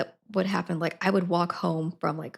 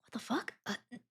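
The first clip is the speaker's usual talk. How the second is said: stunned quiet; 4 semitones higher, 13 dB quieter, faster than usual. Retold or imitated. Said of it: "I was like".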